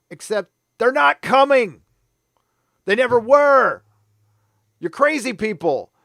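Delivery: angry outburst. Real, not acted. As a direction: really yell it, fed up. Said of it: "Sorry, I get mad".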